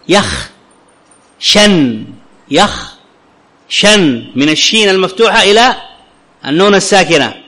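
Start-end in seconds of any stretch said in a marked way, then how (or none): 0.1-4.3 يخشن drawn out very slowly, the alif dropped, sha straight into nun; slow pronunciation demo. Shown as a demonstration. Teacher slowly shows how يخشى الناس is spoken. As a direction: say يخشن syllable by syllable with no long alif. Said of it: "أبقيها، ولكن في النطق احذفها".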